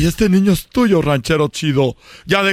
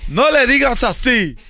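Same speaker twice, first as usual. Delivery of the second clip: protective mother; 7 semitones higher than usual. Acted. Snatching baby, protective mother.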